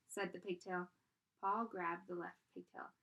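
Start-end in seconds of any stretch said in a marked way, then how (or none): none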